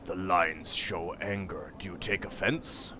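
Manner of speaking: a slow, low, formal stranger's voice; solemn stranger. Acted. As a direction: speak as a grave foreigner. Voices Porphyron.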